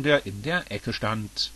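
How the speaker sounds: plain reading voice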